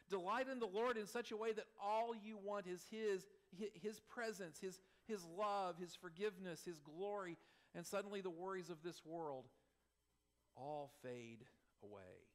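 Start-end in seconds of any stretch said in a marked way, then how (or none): none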